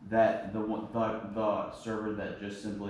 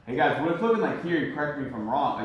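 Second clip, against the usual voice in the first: higher, louder, assumptive pitch to the group; confident, assertive; 4 semitones higher, 5 dB louder than usual. Retold or imitated. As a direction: say it as a statement, sure of yourself. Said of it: "very confident, very assumptive, very assertive".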